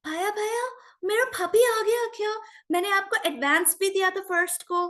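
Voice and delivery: high-pitched imitation of an actress's American accent; mock American accent in Hindi, high. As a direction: mimic a film heroine's American-tinged Hindi, pitched high. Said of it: "I remember this accent".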